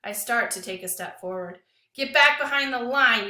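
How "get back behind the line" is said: loud, raised order; angry order. Acted. Lewis ordering the boy back over the line, loud. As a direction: bark him back behind the line.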